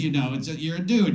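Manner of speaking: ordinary talk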